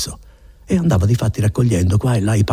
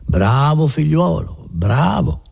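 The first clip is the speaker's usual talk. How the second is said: slow, higher-pitched coaxing approval; smooth, mannered praise; 5 semitones higher, slower than usual. A narrator's reading. Notary humours Renzo to get him moving, all mannered. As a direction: draw the praise out with oily courtesy. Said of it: "rispose il notaio tutto manieroso".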